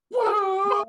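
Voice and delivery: loud, high hyped shout; excited whoa. Real, not acted. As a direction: yell it like the crowd just popped. Hype reaction to entrance.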